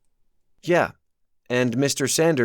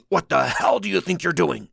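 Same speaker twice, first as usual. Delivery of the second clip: furious demand; 7 semitones higher than usual. A narrator's reading. Sanders furious.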